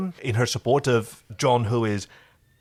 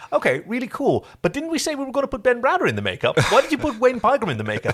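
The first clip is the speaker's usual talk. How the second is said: higher, fast, confused questions; baffled producer; 11 semitones higher, faster than usual. Retold or imitated. Co-host acting out the LA producers puzzled by the dailies, higher and fast. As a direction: rattle off the producer's puzzled complaint.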